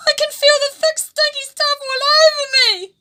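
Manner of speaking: high, loud, tearful disgust; disgusted panic. A narrator's reading. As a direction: wail it in horror. Sludge covering Kahawai.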